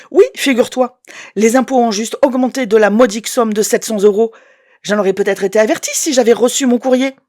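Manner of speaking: loud, biting sarcasm; sarcastic anger. Acted. Woman berating her ex over the tax bill, sarcastic and loud. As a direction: throw the numbers at him. Angry phone row with ex.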